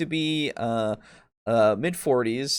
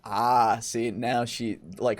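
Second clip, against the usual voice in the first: ordinary delivery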